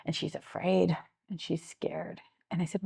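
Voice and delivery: usual speaking voice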